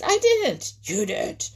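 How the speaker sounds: high squabbling, loudest on the denials; acted storybook bird row; angry quarrel. A narrator's reading. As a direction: bicker furiously over who owns it. Heron and hawk quarrel over frog.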